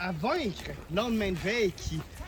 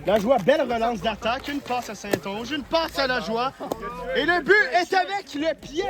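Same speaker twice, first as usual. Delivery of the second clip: excited; 5 semitones higher than usual. Real, not acted. Dangerous shots called live.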